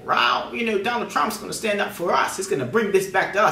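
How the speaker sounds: high-pitched mock excitement of a believer; high, acted wide-eyed hope; hopeful supporter. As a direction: voice an eager supporter, pitched well up. Voicing hopeful Trump supporters.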